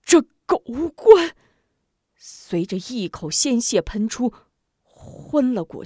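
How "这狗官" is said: furious cry through clenched teeth; strangled voice. Acted. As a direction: squeeze it out through gritted teeth in rage. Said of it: "从牙缝里挤出一句".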